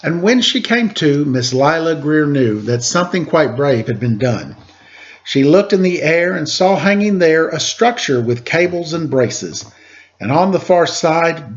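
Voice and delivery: lilting rhymed read-aloud voice; story narration. Acted. Host reading a rhyming picture book to kids, lilting narration. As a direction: read the verse to the children with bounce.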